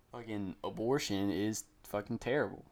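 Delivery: ordinary delivery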